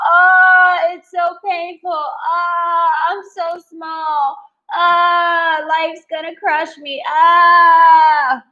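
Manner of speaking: high, pained victim whine; whiny complaining. Shown as a demonstration. Demoing the complaining victim mindset. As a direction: moan like life is crushing you. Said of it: "You can go at it that way".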